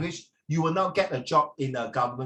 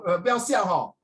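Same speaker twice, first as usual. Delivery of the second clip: ordinary delivery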